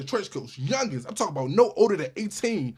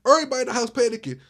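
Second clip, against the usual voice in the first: normal talking voice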